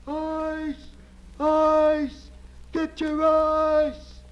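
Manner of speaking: high, long-drawn ice seller's cry; street vendor yelling. Acted. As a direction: holler it like a street hawker, stretching the words. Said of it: "He's yelling at the top of his voice".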